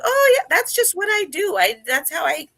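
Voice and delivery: high, breezy mock Corkina, matter-of-fact; imitating Corkina. Teammate imitating Corkina's shrug-off reply in a high breezy voice. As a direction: toss it off like it's nothing, pitched high.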